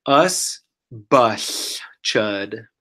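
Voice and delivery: "Us bush chud" said very slowly, word by word; slow demo. Shown as a demonstration. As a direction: stretch "Us bush chud" for the learner to copy. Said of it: "This one is the opposite".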